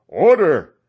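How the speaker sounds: loud call for order; cry for order. A narrator's reading. Ulrich calling the brawling crowd to order, loud cry. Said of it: "cries of".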